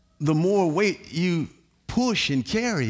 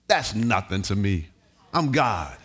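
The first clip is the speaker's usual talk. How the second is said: much lower, calm divine voice; voice of God; 12 semitones lower, 4 dB quieter than usual. Retold or imitated. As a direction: speak as God, unbothered. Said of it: "voicing God".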